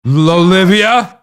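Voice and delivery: long, high, loud name shout; calling out. Real, not acted. Shouting for Olivia over an interruption, loud and high.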